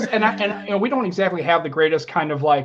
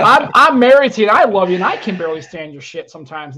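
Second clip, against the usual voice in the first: loud, higher-pitched mock exasperation; critical wife; 4 semitones higher, 8 dB louder than usual. Wife telling podcaster husband off, exasperated. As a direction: imitate a fed-up spouse, raising your voice. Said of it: "is my own worst critic".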